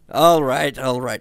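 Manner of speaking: loud, exasperated all right; irritated. A narrator's reading. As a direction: snap it out impatiently. Hans, irritated.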